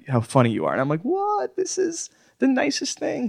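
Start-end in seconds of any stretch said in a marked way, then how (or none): 1.0-3.3 high, slow, breathless delight; squeaky overwhelmed fan reply; starstruck gush. Retold or imitated. Gushing quote of own reaction.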